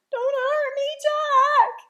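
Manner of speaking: high, loud yell of fear. A narrator's reading. Giant begging Jack in his nightmare, frightened yell. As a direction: cry out desperately for mercy. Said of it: "he yelled".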